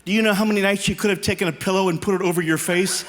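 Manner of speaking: normal talking voice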